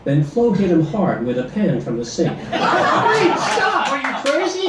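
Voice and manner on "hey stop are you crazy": very high yelps of pain and panic; cried out in pain. A narrator's reading. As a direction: squeal it like you're being hit. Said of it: "Ed cried out in pain".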